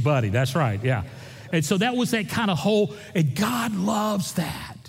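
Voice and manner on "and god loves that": slow, emphatic preacher's call; preaching emphasis. Real, not acted. Pastor preaching to his congregation, slow emphatic declaration. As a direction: lean into it, slow and full.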